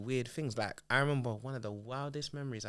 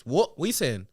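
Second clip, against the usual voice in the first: louder, raised-pitch mock aggression; confrontational challenge; 4 semitones higher, 7 dB louder than usual. Schoolboy challenging classmates over a joke, mock-aggressive. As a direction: square up to a rival, acted out. Acts out squaring up for a fight.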